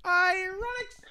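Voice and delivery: very high, loud, drawn-out call; high-pitched cry. Pretend. Host pitching his alternative nickname, high held cry. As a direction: belt out the name in a held high voice.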